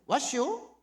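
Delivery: blunt country barber asking; Chungcheong greeting. Retold or imitated. Barber greeting the foreigner in Chungcheong dialect. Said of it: "충청도 인사말이죠".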